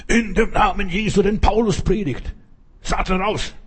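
hoher, gespielter Exorzistenruf; mock exorcists. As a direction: wichtigtuerisch den Teufel austreiben. Söhne des Kephas exorzieren. (hohe, spöttische Exorzistenstimme)

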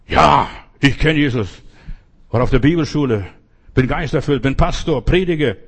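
loud, emphatic boasting of credentials; retold cocksure retort, punched out loudly; indignant self-assurance. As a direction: fire back proudly, listing your credentials. Indignant self-assured answer to professor.

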